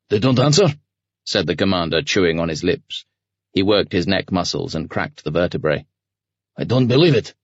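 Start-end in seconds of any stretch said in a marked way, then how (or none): 0.1-0.7 a voiced commander, sharp question; gruff commander voice. A narrator's reading. 6.5-7.3 gruff commander voice. A narrator's reading. Commander reacts to silence.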